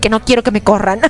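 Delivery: usual speaking voice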